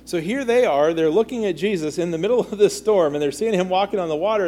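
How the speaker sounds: usual speaking voice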